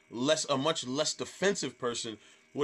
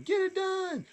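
very high mock heckler holler; imitated yell; slower than usual. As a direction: squeal the catchphrase like a fan. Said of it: "So she was yelling".

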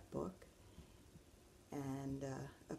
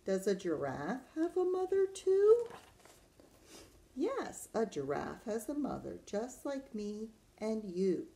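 put-on reading to children; 5 semitones higher, 5 dB louder than usual. Teacher reads giraffe page to children, lilting storytime voice. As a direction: question climbing high and loud, then a lilting answer.

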